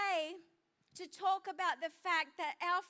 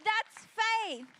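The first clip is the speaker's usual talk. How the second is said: louder, higher, driving the point home; emphatic declaration; 4 semitones higher, 6 dB louder than usual. Real, not acted. Preacher to congregation, proclaiming faith louder. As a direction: proclaim it like the answer to everything. Preacher repeating the key line.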